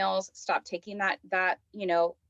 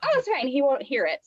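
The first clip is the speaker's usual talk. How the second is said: high, louder airy reassurance; a bright, breezy voice telling yourself it's fine; breezy self-reassurance; 6 semitones higher, 4 dB louder than usual. Retold or imitated. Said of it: "so I'm like".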